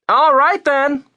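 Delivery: loud, very high woman's shout; calling back. A narrator's reading. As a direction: shout agreement back across the street. Woman shouting back across the street.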